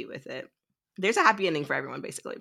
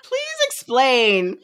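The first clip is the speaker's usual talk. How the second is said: high, drawn-out baffled plea; exaggerated bewildered plea; mock pleading; 9 semitones higher, 4 dB louder, much slower than usual. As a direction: stretch it out high, begging for clarity. Retold baffled plea for explanation.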